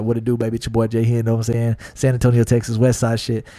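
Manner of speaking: swagger like a West Side thug. Retold or imitated. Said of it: "I'm a thug all day".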